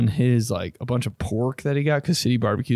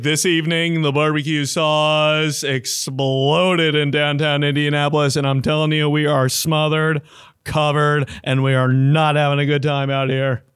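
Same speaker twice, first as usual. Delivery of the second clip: news anchor voice; 3 semitones higher than usual. Retold or imitated. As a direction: deliver it like the evening news. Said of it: "still using his news anchor voice".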